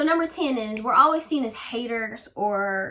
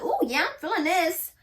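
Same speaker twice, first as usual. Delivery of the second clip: higher-pitched imitation of pleased girls; mock delight; 7 semitones higher than usual. Light-skinned girls admire the lipstick, mock delight. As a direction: play at loving it, pitched up. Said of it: "all the light-skinned girls were feeling, they were like".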